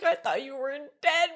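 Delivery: high, gleeful mock relief; hammed-up joy at the return; overjoyed. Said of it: "He was, like, so happy".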